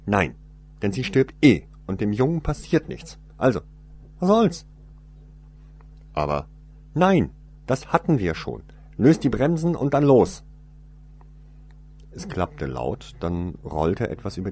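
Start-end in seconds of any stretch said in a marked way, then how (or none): 0.1-10.4 higher, louder, snapping orders; sharp, dismissive commands; impatient woman. Acted.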